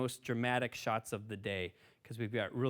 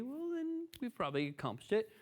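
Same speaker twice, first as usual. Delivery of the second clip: playful high voice; 11 semitones higher than usual. Pretend.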